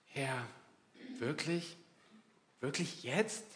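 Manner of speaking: softly questioning, pausing between words; a hushed, incredulous question with a rising end; inner disbelief. Acted. Believer inwardly asking God why now, hushed disbelief. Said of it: "innerlich, also".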